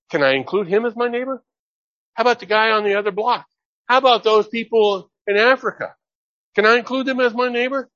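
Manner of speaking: high, fast, searching questions; eager questioner. Retold or imitated. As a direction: ask it like someone newly eager to include. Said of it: "purpose of the Good Samaritan is".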